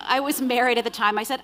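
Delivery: ordinary delivery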